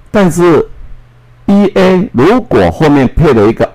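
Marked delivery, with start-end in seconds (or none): none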